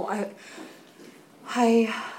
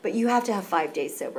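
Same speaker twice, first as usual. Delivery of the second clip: usual speaking voice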